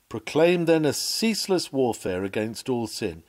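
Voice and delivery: ordinary delivery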